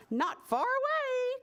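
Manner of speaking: high sing-song hen; chiming hen. Acted. Hen luring the thieves, high chiming voice. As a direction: chime in high and bright. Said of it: "chimed in the hen".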